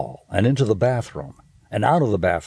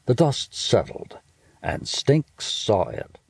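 ordinary delivery

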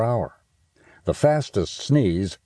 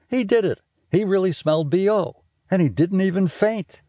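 amazed; 12 semitones higher than usual. Acted. Classmate amazed at Stink.

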